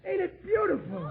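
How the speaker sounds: a high, thrilled exclamation; excited admiration. Acted. Riley admiring the big tool chest he was given, high excited. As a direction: marvel at the gift.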